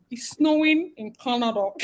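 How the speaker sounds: exaggerated fake Canadian vowels; put-on Canadian accent. Shown as a demonstration. Vlogger telling viewers about snow, mock Canadian accent. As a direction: do a badly faked Canadian accent. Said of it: "I could not pick up Canadian people accent".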